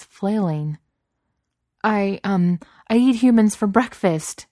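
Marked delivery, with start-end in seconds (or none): 1.8-4.5 high, loud, flustered wailing; desperate wail. A narrator's reading. Beast desperate to seem man-eating to Cook, wailing. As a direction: wail out a feeble threat. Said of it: "the beast wailed".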